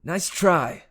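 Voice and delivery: high, loud comeback; defiant retort. A narrator's reading. Hero refusing the Fury's offer, defiant retort. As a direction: throw it back at the villain, loud.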